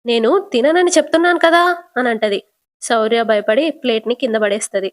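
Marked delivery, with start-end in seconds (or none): 0.1-1.8 higher, louder protest; sharp loud retort. Acted. Deepa to her family, refusing food with a loud retort. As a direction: snap back loudly in refusal. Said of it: "గట్టిగా".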